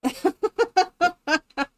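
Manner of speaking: high, rapid giggle; helpless staccato laughter; real amused laugh. Real, not acted. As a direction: burst into a run of high giggles. Amused by a viewer's message.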